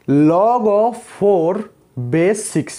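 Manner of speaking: extremely slow and drawn out; slow demo reading. Shown as a demonstration. Teacher showing students how to read the log, very slowly. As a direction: stretch out "log of four base six". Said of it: "इसको हम कैसे करके पढ़ेंगे देखो".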